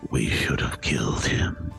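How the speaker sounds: low whispering inner voice; pixie. Acted. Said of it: "a little voice inside your head say".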